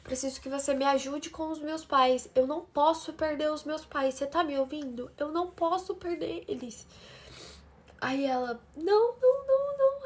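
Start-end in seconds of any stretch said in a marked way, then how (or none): 0.0-6.9 súplica firme. Retold or imitated. Implora firme à cunhada em choque. 8.7-10.0 voz muito aguda e alta, soluçando não; grito chorado. Retold or imitated. Cunhada desaba ao ouvir a notícia.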